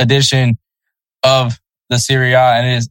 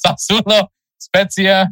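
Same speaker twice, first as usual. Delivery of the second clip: laughing; 6 semitones higher, slower than usual. Real, not acted. Stumbling over a name, laughing.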